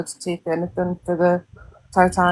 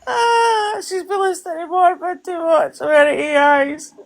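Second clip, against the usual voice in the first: laughing; 11 semitones higher than usual. Real, not acted. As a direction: crack up while saying it. Laughing reaction to clip.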